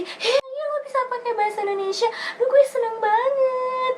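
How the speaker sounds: very high delighted gush; thrilled someone speaks Indonesian; mock teary joy, high pitched; overjoyed squeal. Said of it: "Terus kamu pasti".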